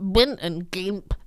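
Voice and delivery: slow, croaky goblin voice; grotty creature voice. Acted. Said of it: "grotty creature voices".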